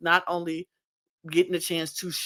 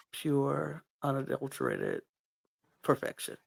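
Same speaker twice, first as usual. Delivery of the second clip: much softer, lower, fading to breathless; awed hush; 3 semitones lower, 10 dB quieter than usual. Real, not acted. Reviewer stunned by a perfect jive, softer and breathless. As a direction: let it fall away in awe. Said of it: "nothing to say but".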